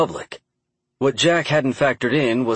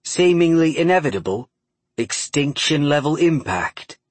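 put-on Cockney accent; 5 semitones higher than usual. A narrator's reading. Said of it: "broad Cockney accent".

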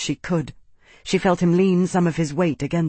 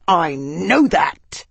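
slow snarl, a little louder; 2 semitones higher, 4 dB louder, much slower than usual. A narrator's reading. Arnas cutting off his advisor, slow snarl. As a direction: grind the words out in anger. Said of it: "Arnas snarled".

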